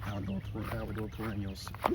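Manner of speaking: soft, cracking voice. Real, not acted. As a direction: talk quietly as your voice keeps cracking. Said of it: "my voice is cracking a bunch".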